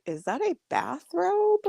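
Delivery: high slow puzzled question; puzzled husband voice. Retold or imitated. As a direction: ask it baffled and high. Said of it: "Roman's just like".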